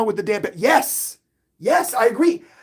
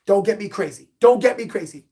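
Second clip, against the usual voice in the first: ordinary talk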